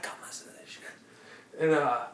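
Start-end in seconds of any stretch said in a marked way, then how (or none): 0.0-0.8 hushed excited whisper; whispered aside. Retold or imitated. Whispering to Kelly to come eavesdrop, hushed. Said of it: "I'm like".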